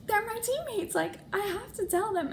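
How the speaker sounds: high, lightly voiced matter-of-fact boyfriend; mock his reasoning lightly; mock boyfriend reply. Boyfriend Zach explaining he must tell teammates, lightly mocked.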